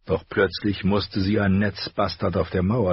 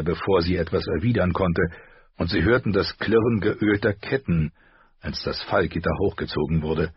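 ordinary delivery

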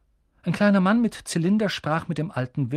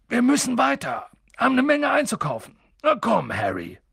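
much higher and louder, calling over the crowd; lauter Hagrid; 9 semitones higher, 4 dB louder than usual. Acted. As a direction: make yourself heard in the noisy pub. Hagrid ruft über die Menge. (Hagrid ruft laut über die Menge)

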